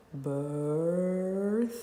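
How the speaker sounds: birth drawn out long on one held vowel; slow, elongated "birth"; stretched single sound. Shown as a demonstration. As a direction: hold the vowel of birth, then the th.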